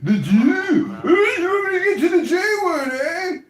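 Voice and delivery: high, loud fake sob over the words; mock crying. Taunting the room with loud fake crying. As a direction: wail it in hammed-up mock tears.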